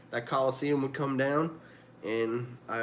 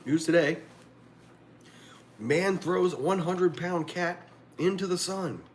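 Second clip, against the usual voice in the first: mock news headline; 6 semitones higher than usual.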